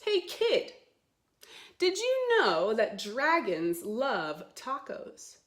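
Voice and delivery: loud, lively storybook read; reading to children. Acted. Teacher reading the dragon book aloud to kids, loud and bouncy. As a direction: sell every taco like a big surprise.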